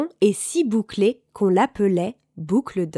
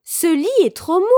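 higher-pitched storybook complaint; exclaiming; 7 semitones higher, 4 dB louder than usual. A narrator's reading. Goldilocks lying on the second bed, exclaiming.

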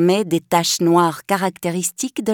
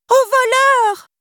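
very high, louder, slow cry for help; Nanny crying out; 4 dB louder, slower than usual. Acted. Nanny on the pavement as the thieves flee, crying out. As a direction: scream it into the street. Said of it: "cria la pauvre Nanny".

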